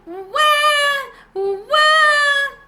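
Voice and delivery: put-on baby wailing. A narrator's reading. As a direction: howl like a crying infant. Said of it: "the blanket made a very loud noise".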